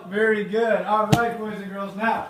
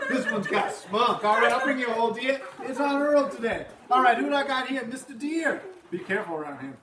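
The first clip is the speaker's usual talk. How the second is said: hammed-up diner cook rushed off his feet; Earl the cook; 3 semitones higher than usual. Teacher as cook Earl takes the kids' orders, hammed up.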